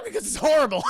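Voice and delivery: excited. Real, not acted. Laughing after the scream.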